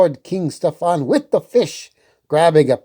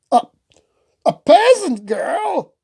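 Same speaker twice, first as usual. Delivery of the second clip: shocked disbelief; 13 semitones higher than usual. Acted. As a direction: choke on the word peasant. Hubert shocked at news.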